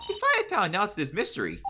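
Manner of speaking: high squealing cheer, fast; excited cheer. Acted. Host cheers the player.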